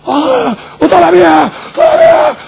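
very high, drawn-out excited commentary; frenzied match commentator. Acted. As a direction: yell it like live play-by-play. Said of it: "وتشوف بقى اللي بيذيعوا". (صراخ معلق كرة متحمس)

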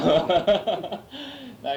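loud, high laugh; burst of laughter. Real, not acted. Laughing at telling the story.

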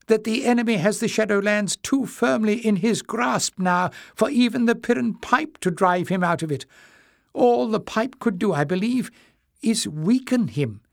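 put-on high old man's voice, careful; a timid old man venturing a doubt; old Joseph, cautious.

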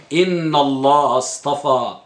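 low, slow recited verse; Quran recitation. Acted. Teacher begins reciting the verse to students, slow and low.

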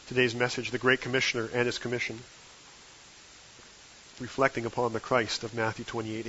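ordinary talk

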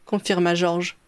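plain reading voice

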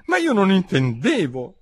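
high, plaintive protest; supplicating little-girl voice; pleading. A narrator's reading. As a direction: beg to be understood. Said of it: "supplicò la povera Alice".

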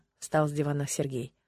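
ordinary delivery

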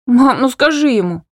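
high loud tearful plea; pleading. Acted. Hanna pleading to her mother with forced tears, high and loud. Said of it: "Взмолилась я, выдавливая слёзы".

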